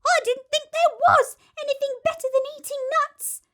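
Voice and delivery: high, bright monkey chirp; cheeky monkey voice. Acted. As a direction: play a chirpy little monkey.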